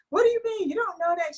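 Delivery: high incredulous squeak; shocked disbelief. Retold or imitated. Sister reacting in shock to the pen-name plan. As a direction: pitch way up in disbelief.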